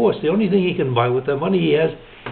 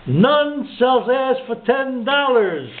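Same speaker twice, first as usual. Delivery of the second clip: pitched up and stretched out; slow, higher punchline headline; 7 semitones higher, slower than usual. Acted. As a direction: deliver the headline as the big laugh line. Newspaper headline punchline read out.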